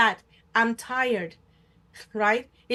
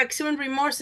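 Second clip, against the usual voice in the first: normal talking voice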